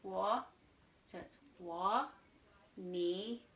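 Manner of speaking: wo and ni dipping, then rising; third tone. Shown as a demonstration. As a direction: Mandarin third tone on "wo" and "ni". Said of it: "we've got two third tone".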